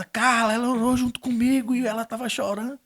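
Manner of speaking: high, loud, eager telling; excited news. Retold or imitated. Father arriving home telling Carla the news, excited. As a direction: burst in with the good news.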